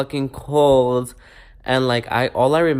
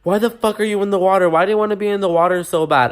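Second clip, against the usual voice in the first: raised, higher-pitched furious demand; angry trainer yelling; 7 semitones higher, 5 dB louder than usual. Retold or imitated. Instructor shouting at a student in the pool, angry. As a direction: bark it at her like a fed-up instructor.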